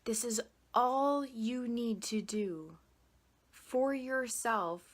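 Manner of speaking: soft, quiet counting voice with whispered holds; guided breathing. Acted. Coach leading viewers through box breathing, soft guided voice.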